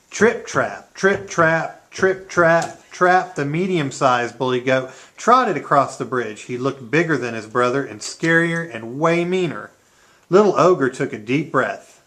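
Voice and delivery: usual speaking voice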